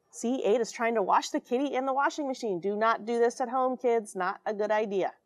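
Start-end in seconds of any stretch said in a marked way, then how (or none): none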